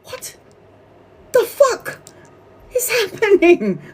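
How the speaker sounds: very high, far above normal pitch, broken into pieces; stunned disbelief. Retold or imitated. Reader reliving the shock of mum's death date, stunned disbelief. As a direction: squeak it out in stunned pieces with long gaps.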